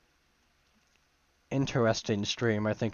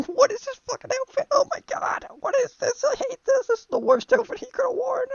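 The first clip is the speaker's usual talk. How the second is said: very high, loud laughing outburst; laughing disbelief; 5 dB louder than usual. Real, not acted. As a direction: crack up while yelling in horrified amusement. Cracking up at ridiculous outfit.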